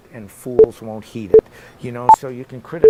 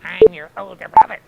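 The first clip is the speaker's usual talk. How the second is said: high-pitched mock yell; hammed-up indignant protest; retold sibling retort; 8 semitones higher than usual. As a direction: play an indignant older brother snapping back at sister. Acted sibling quarrel.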